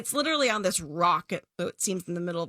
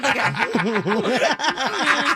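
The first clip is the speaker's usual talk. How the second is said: laughing; 5 semitones higher, 7 dB louder than usual. Real, not acted. Laughing mid-sentence.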